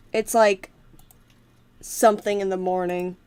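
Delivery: normal talking voice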